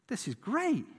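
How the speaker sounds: excited villagers. Retold or imitated. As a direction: cheer like the relieved villagers. People cheer the farmer.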